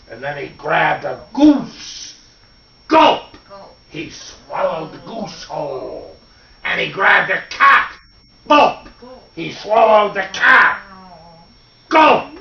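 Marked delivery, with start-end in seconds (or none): 2.9-3.4 sudden high, punched gulp; loud gulp. A narrator's reading. Swallowing sound effect in story. 8.5-8.9 sudden high, punched gulp; loud gulp. A narrator's reading. Swallowing sound effect in story. 11.9-12.4 sudden high, punched gulp; loud gulp. A narrator's reading. Swallowing sound effect in story.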